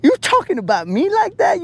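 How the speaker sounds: high, indignant squeak; offended. Retold or imitated. Host confronting the alien, high mock indignation. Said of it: "And I was like".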